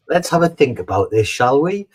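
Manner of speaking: a knowing, sarcastic let's-think-it-over; mock patient. Mild sarcasm toward a prick colleague.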